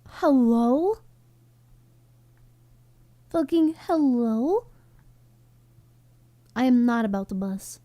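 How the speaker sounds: ordinary delivery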